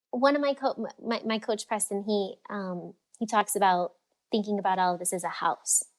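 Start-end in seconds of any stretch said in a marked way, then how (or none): none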